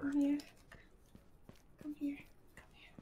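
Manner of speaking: hushed animal call, trailing away; soft coaxing. Real, not acted. Player calling an animal over, soft coaxing. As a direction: coax softly, fading toward a whisper.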